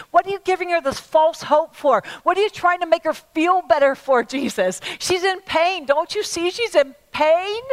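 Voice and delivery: acted indignant pleading, climbing in pitch; protesting onlooker. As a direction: remonstrate on behalf of a grieving woman. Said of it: "I'm sure they're thinking".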